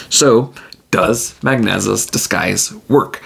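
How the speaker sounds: ordinary talk, nothing marked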